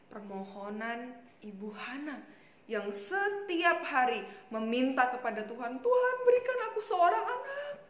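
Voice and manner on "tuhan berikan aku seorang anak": high, loud acted begging; earnest plea. Hana begs God for a child, acted earnest plea. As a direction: cry out the prayer, pitched right up. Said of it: "Dengan sungguh-sungguh ya".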